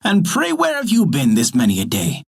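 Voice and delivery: put-on high-pitched scolding old dame; angry scolding. A narrator's reading. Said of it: "the dame grew very angry and began scolding him".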